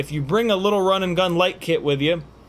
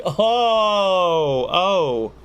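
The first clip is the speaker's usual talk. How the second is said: dawning-realization "oh"; 4 semitones higher, 4 dB louder than usual. Acted. Friend finally understands the band name.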